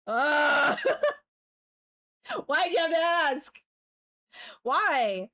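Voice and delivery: loud anguished shouting. Real, not acted. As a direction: yell out in mock despair. Freaking out at chat question.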